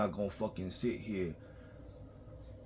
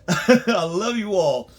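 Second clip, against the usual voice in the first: laughing; 8 semitones higher, 11 dB louder, much slower than usual. Real, not acted. Laughs while saying it.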